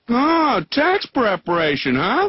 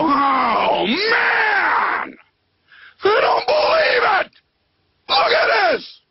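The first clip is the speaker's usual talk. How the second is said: much higher, shouting in a tiny squeaky ant voice; shocked little ants; much slower than usual. Pretend. Ants see their pile knocked over, high shouting. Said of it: "they just all go".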